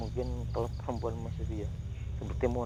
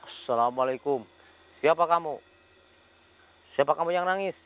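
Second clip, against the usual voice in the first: louder and higher, challenging call into the dark; 5 semitones higher, 6 dB louder than usual. Real, not acted. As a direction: demand who is there, loud and firm. Calling out to crying ghost.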